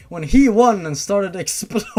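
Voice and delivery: words breaking up into giggles; real giggling read; laughing while reading. Real, not acted. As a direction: read through helpless laughter.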